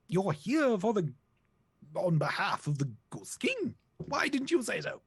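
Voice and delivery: high, strained creature voice that slips; botched octopus voice. Acted. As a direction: squeak out the octopus's lines, fumbling the voice. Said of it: "what is wrong with my voices today".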